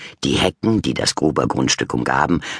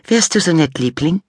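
high, louder coaxing voice; sweet wife; 4 dB louder than usual. A narrator's reading. Wife sweetly asking husband to pluck grass.

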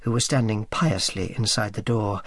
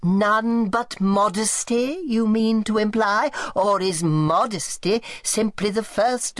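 whining, creaking aunt; 10 semitones higher than usual. Acted. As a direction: creak it out like a frail old tyrant. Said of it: "Her voice whined and creaked".

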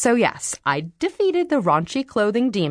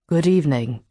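lower, smooth English baritone greeting; refined English accent; 3 semitones lower than usual. A narrator's reading. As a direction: greet her like a polished English gentleman. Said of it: "spoke in his refined baritone English accent".

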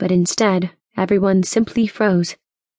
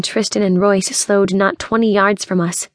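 plain reading voice

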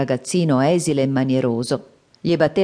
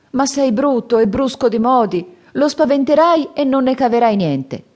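higher, anxious monk; fretful master; 9 semitones higher, 4 dB louder than usual. Acted. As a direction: worry aloud about the ogres. Tripitaka objects. (Tripitaka impaurito, voce più alta)

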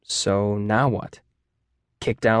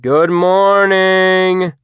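loud, long-drawn higher yell; group yell; 7 semitones higher, 6 dB louder, much slower than usual. A narrator's reading. Students answering the teacher to make her stop, loud drawn-out yell. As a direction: yell it back, stretching out every syllable. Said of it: "The students yelled".

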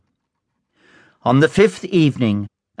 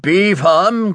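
BE appuyé, plus haut, ton ferme; stern reminder; 6 semitones higher than usual. A narrator's reading. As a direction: insiste sur le mot Be, voix dure. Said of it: "he reminded her".

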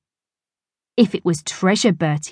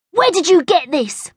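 put-on boy voice; 11 semitones higher, 3 dB louder than usual. Bertie voiced in story.

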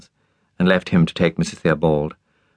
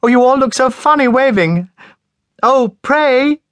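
much higher and louder, giggly; laughing helplessly; 6 dB louder, slower than usual. A narrator's reading. Lilia shouting back from the train, laughing. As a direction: call it out, unable to stop laughing. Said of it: "And laughing helplessly".

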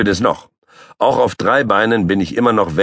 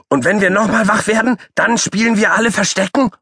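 high-pitched excited little animal; shrill repeated plea; squeaky child call. Acted. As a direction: chant it like a pestering puppy. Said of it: "ruft Paule".

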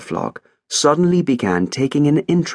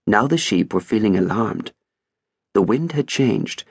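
plain reading voice

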